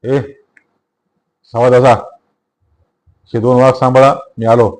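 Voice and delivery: loud command to the guards; barked order. A narrator's reading. Inspector loudly orders his constables to guard the prisoners. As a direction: snap orders before running off. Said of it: "फौजदारांनी पोलिसांना हुकूम दिला".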